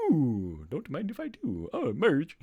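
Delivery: hammed-up glutton impression; Homer Simpson. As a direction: imitate a greedy cartoon dad delighted by a treat. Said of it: "trying to remember what Homer Simpson says".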